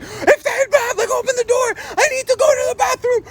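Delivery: high-pitched hammed-up furious yell; yelling grandpa. Grandpa at his limit to toddler, mock furious yelling. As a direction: lose it completely, mock screaming. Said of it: "my grandpa is yelling at her".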